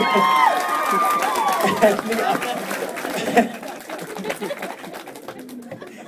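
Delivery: breathless high wheeze; silent wheezing laugh. Real, not acted. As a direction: laugh without sound.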